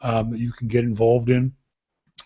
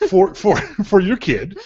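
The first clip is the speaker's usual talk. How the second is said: normal talking voice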